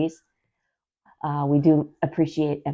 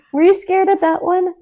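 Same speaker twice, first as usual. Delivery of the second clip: laughing question; 9 dB louder than usual. Real, not acted. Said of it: "laughing after question".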